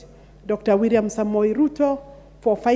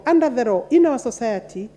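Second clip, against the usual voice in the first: ordinary talk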